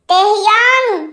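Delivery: loud, drawn-out shout of a name; long, loud call. Acted. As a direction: cry out the name at full voice. Calling out a name in shock.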